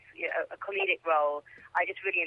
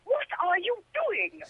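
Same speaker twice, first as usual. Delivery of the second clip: slow, higher, very British challenge; very British accent; 12 semitones higher, slower than usual. Retold or imitated. Guest retells confronting a man chasing her, very British accent. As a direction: retell it in a prim British voice, slowly. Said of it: "in a very British accent".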